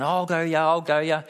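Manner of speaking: ordinary talk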